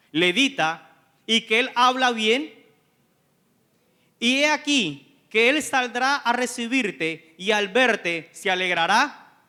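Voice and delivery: loud, ringing pulpit delivery; preaching at full force. Acted. Preacher retelling Scripture to the congregation, loud and ringing.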